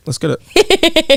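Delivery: high, slow laughing cheer; hype cheer. Real, not acted. Cheering partner's recovered thought.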